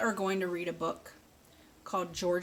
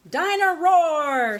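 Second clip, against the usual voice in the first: put-on excited toddler squeal; 9 semitones higher, 12 dB louder, slower than usual. George calls in bath.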